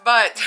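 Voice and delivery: short airy laugh; breathless laugh. Real, not acted. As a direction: laugh while catching breath. Laughs at herself, winded.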